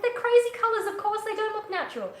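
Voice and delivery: high, loud mock-incredulous comeback; exasperated retort. To the dread makers, an exasperated high retort. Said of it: "I'm like".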